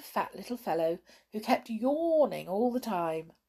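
ordinary delivery

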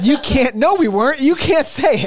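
high, loud laughing protest. Real, not acted. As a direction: shout it down, half laughing. Friends scolding a crude joke.

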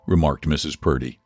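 usual speaking voice

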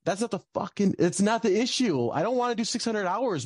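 retold outburst. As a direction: indignantly rail against the advice. Angry retort to supervisor, retold.

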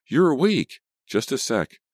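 high, loud, excited call; delighted high-pitched shout; calling back. A narrator's reading. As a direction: call brightly through to the next room. Calling back to friend in bedroom.

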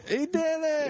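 high cheering shout; excited cheer. Pretend. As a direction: cheer the landing. Mock cheering plane rescue.

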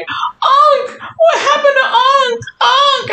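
high, mock-anguished cry; distraught dog owner. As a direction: imitate the owner wailing for his dog. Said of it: "He's like".

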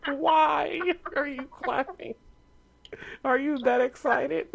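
high, squealing laughter; laughing, high-pitched. Real, not acted. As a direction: laugh in delighted disbelief. Friend clapping over the result.

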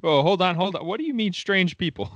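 high-pitched, incredulous interruption; incredulous challenge. Real, not acted. Cohost questioning "strange people", incredulous.

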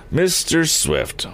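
slow, solemn address, starting high. A narrator's reading. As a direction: draw the name out with gravity. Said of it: "went on the chief solemnly".

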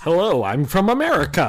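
mock American, every syllable exaggerated; overpronounced American accent. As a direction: ham up a bland American accent. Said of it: "American accents are just the most ... overpronunciations".

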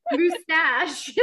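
"Mustache" with a Cockney-tinged vowel; Cockney-ish mustache. Retold or imitated. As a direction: imitate her British "Mustache". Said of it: "why you're like".

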